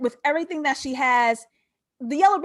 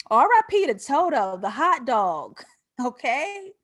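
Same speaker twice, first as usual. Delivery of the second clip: usual speaking voice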